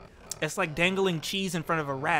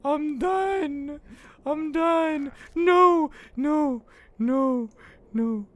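high, loud giddy babble; overwhelmed with joy; giddy disbelief; 9 semitones higher, 3 dB louder, much slower than usual. Real, not acted. Streamer overwhelmed after winning, giddy high voice. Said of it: "I'm crying".

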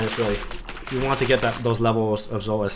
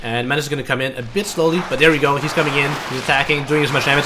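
ordinary delivery

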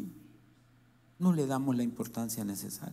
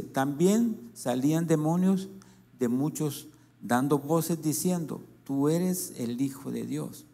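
verse reread with force; 4 dB louder than usual. Shown as a demonstration. Pastor rereading the last verse to the congregation, emphatic. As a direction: hammer home the last verse again. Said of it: "Leamos el último versículo".

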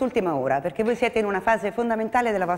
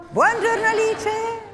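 sarcastic high greeting; 11 semitones higher, slower than usual. Acted.

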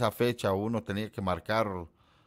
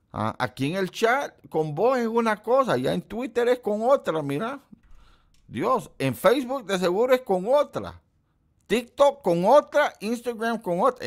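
mock outrage; 12 semitones higher, 4 dB louder than usual. Teasing Pájaro for cheating on Kelly, high loud mock outrage. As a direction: scold a friend in playful fake shock.

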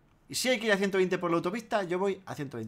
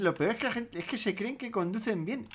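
ordinary talk, nothing marked

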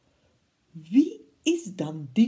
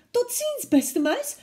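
much higher, alarmed owl cry; startled owl; 5 dB louder than usual. Acted. Owl sees the Gruffalo, high and startled. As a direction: gasp it in fright. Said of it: "zei hij".

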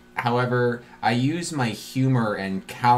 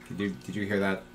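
hushed; 3 semitones lower, 9 dB quieter, faster than usual. Acted. Spooked question to companion.

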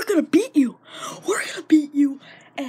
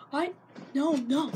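ordinary delivery

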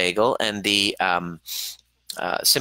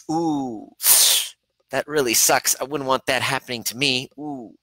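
higher, pitying "ooh, that really sucks"; mock sympathy; 4 semitones higher than usual. Shown as a demonstration. Coach demonstrating sympathy to parents, a pitying higher voice. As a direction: act out hollow pity from a distance. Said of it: "Sympathy is".

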